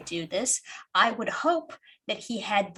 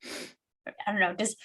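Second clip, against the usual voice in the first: normal talking voice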